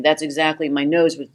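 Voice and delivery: usual speaking voice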